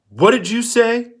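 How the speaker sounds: demanding. Acted. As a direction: bark the question at him like a threat. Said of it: "the lump demanded".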